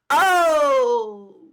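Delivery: long, loud falling "oh!"; triumphant whoop. Pretend. Comedian celebrating her own burn on America, loud drawn-out whoop. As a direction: crow it like a burn just landed.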